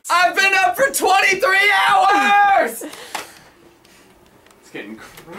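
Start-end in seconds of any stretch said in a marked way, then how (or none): 0.1-2.8 slow, high, drawn-out shout; overtired yelling. Real, not acted. Crew member up for twenty-three hours, high drawn-out yelling. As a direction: yell it out, stretching the last word. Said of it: "Who's yelling?".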